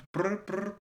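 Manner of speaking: mock phone ringing; phone ring imitation. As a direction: make a phone ringing noise.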